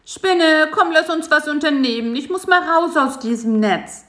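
high, whiny bored insect voice; whining. A narrator's reading. Bisi begging the spider to go out, high and whiny. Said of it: "quengelte Bisi".

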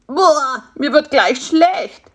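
loud, high groan of revulsion; disgusted. Acted. Fly is offered midge wings by spider, revolted. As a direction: sound about to be sick.

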